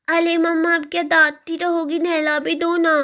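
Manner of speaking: put-on high lisping child voice; little child. Daughter tired of being scolded asks mamma for a bath, high baby-talk plea. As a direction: nag mamma like a pleading toddler.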